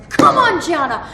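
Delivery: high, loud coach-like shout; loud Irish cheer from beside the runner; encouraging yell. Retold or imitated. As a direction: cheer her on with all you've got. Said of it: "he starts yelling at me".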